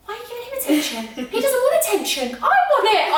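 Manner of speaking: put-on high, whiny little-kid protest; jealous child. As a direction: imitate a kid demanding all the attention. Acts jealous childhood self.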